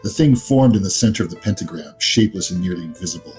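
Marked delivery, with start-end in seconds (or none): none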